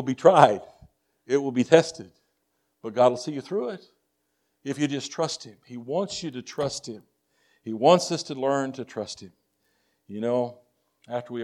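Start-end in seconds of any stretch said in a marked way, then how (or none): none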